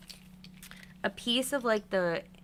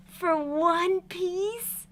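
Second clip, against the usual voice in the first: stretched disbelief; 9 semitones higher, 4 dB louder, much slower than usual. Real, not acted. Disbelief over piece.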